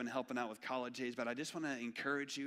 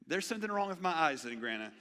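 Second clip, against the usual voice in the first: put-on higher, louder boy realising; puzzled kid; 6 semitones higher, 11 dB louder than usual. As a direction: play the baffled kid. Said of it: "And I said".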